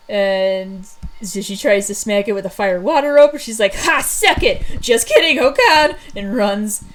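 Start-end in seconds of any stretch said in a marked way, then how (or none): 3.7-4.6 loud, high triumphant jeer; cocky taunt. Acted. Thunder taunts the beast she just smacked, cocky and loud. 4.8-6.0 loud, high comic backpedal; sudden playful alarm, pitched up; mock panic. Thunder backs down as the beast charges, in loud mock panic.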